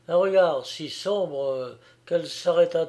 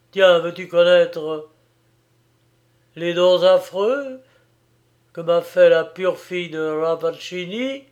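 bitter challenge; 3 semitones higher, 6 dB louder than usual. A narrator's reading.

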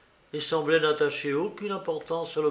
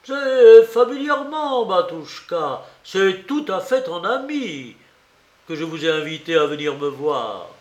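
high, loud mock friendliness, settling down; derisively chummy, starting high and easing; sardonic; 3 semitones higher, 7 dB louder than usual. A narrator's reading. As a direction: gush with false familiarity. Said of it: "de son ton narquois".

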